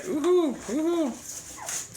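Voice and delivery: two high-pitched whoops; excited cheer. Real, not acted. Parent cheering kids on with packs, excited cheer. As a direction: whoop high, then let it tail off.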